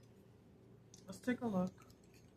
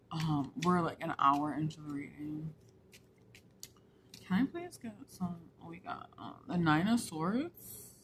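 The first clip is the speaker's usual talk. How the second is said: ordinary delivery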